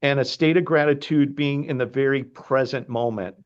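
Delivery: ordinary talk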